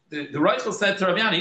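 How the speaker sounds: ordinary talk, nothing marked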